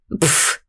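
unvoiced dismissive snort through the lips; skeptical snort. A narrator's reading. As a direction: scoff with a puff of air. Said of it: "фыркнула скептичная фея".

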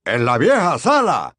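alarmed disbelieving question; surprised. Acted. Weasley echoes in shock.

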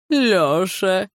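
a lightly sing-song teacherly «Лёша!»; kindergarten teacher calling. Retold or imitated. Val teasing Lyokha by calling his name like a kindergarten teacher. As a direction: call him like a nursery teacher. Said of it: "как будто воспетка в детском саду зовёт".

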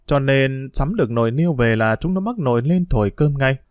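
plain reading voice

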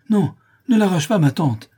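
high, loud protest; alarmed cry. A narrator's reading. Boy begs aunt not to pull tooth, alarmed cry. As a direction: beg in sudden panic. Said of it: "s'écria Tom".

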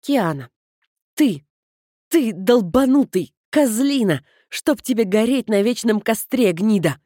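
loud furious swearing at her brother; furious yelling. Acted. Sister screaming curses at her prankster brother, furious. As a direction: spit the insults out in a rage.

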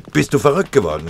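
loud, high alarmed yell; shouting. Acted. Tinnes stops Linsenmeier from firing, shouting. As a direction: shout him down before he fires. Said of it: "schrie er".

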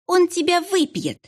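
high shrieking witch taunt; shrill taunt. Acted. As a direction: screech it at him. Director taunting. (визгливая насмешка)

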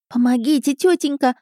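put-on high, pleading boyish whine; whining. A narrator's reading. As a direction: whine for auntie's help. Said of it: "заныли мальчишки". (жалобное мальчишеское нытьё)

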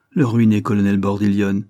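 ordinary delivery

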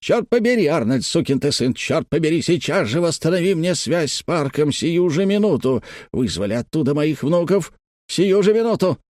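high-pitched tantrum at normal volume; angry yelling. A narrator's reading. Boss berating his engineer over the lost link, yelling. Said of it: "вопил и топал миниатюрным ботинком".

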